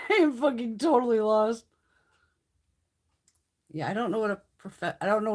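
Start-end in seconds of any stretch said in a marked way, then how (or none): 0.1-1.6 laughing through the words; amused, giving up on following; real laugh of confusion. Real, not acted. Laughing about being lost.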